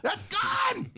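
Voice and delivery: very high, strained comic outcry; panicked inner voice. Acted. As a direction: wail it like the leverage is slipping away. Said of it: "I had that one voice going".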